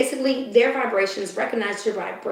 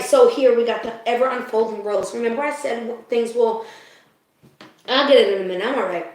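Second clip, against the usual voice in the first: ordinary talk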